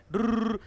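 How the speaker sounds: quick rolled whirr; playful zooming sound effect; whirring noise. Shown as a demonstration. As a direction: trill it like a line zipping across. Imitates noise of connecting a line.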